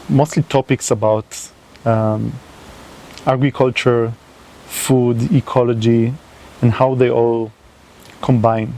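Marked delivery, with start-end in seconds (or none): none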